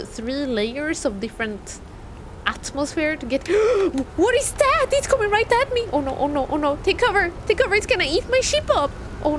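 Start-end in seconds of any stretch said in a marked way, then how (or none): 3.5-4.1 sharp frightened gasp; startled gasp. Real, not acted. Startled gasp as a creature charges the ship. Space monster attacks ship. 4.2-5.9 higher, startled and scared; panicking at something coming; alarmed. Real, not acted. Streamer panicking as a creature charges the ship.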